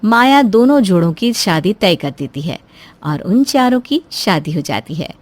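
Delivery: plain reading voice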